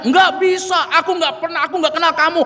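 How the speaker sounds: indignant denial. Retold or imitated. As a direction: shout the denial, offended. Fulan denies accusation loudly.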